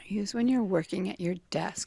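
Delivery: whispered repeat of a clue; thinking aloud. Real, not acted. Contestant mulling over a desk clue, in a whisper. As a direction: murmur it softly to yourself.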